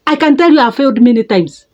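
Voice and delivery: ordinary talk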